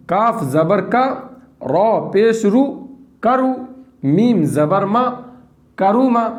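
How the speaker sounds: slow syllables, then the joined word lifted; slow spelling drill. Shown as a demonstration. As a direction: model it for pupils. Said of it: "इस तरीके से".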